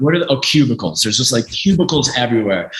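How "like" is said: usual speaking voice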